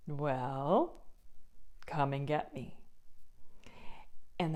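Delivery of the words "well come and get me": lower, softer calm dare; cool taunt. Acted. Biggest goat daring the troll to come, low and cool. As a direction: dare him quietly.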